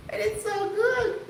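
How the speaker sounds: high giggle into the words; high laughing. Real, not acted. Laughing about rich quiche.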